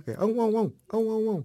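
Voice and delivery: high barking ão, ão, ão; mock dog bark. Imitating a barking dog for the band name. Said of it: "do cão a ladrar".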